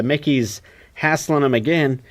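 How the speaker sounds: ordinary delivery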